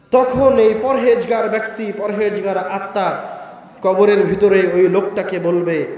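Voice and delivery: very loud, high proclaiming sermon; preaching at full force. Acted. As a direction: proclaim it like a call from heaven. Preacher rising again.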